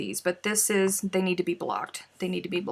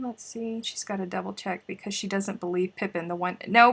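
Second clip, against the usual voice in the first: normal talking voice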